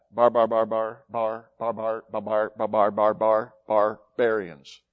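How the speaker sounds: low, monotone run of "bar, bar, bar"; mimicked foreign babble. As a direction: imitate gibberish speech as repeated low syllables. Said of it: "It sounded to them like".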